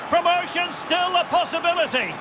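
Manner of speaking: high, sustained commentator's cry; excited goal call. Real, not acted. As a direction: call the equaliser with soaring excitement. Commentator calls the equalising goal.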